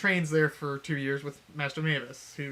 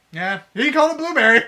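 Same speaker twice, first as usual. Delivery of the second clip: higher and louder, laughing through the words; laughing delight; 11 semitones higher, 11 dB louder than usual. Real, not acted. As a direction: burst out laughing at the name. Laughing at the bear's name.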